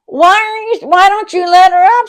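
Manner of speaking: high, loud demand; high, loud yell at the sister; exasperated yell. Retold or imitated. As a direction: shout at her to let Mom up. Teller shouting at sister.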